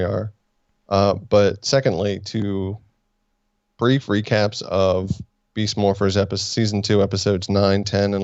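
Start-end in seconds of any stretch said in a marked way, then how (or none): none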